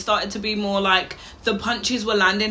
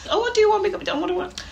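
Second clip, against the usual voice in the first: exasperated; 12 semitones higher, faster than usual. Real, not acted. Exasperated at the rapper.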